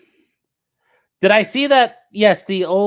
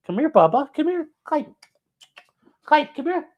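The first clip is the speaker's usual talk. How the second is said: much higher, sing-song pet voice; calling the dog; 12 semitones higher than usual. Retold or imitated. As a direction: coax the dog over in baby talk.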